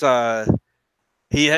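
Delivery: normal talking voice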